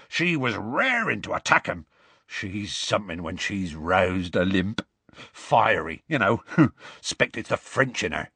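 admiring rustic voice with a little chuckle; Hagrid, smitten. Acted. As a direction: reminisce fondly about her.

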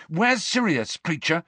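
demanding. A narrator's reading. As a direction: demand an answer from a servant. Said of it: "Harry demanded".

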